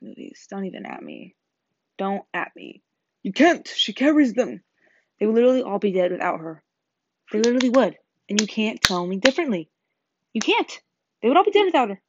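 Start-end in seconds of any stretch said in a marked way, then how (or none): 3.2-12.0 loud, insistent fan rant; worked-up, emphatic insistence. Real, not acted. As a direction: defend her loudly, pitch jumping up on the protests. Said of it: "sit here and rant".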